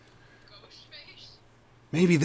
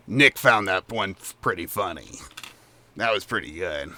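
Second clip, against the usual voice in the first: much lower and softer, rumbling goblin; a low, hurting throat voice; low Chucklehead voice; 6 semitones lower, 4 dB quieter than usual. Acted. Chucklehead tells party Nick found it funny, low rumble. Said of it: "yeah, he says".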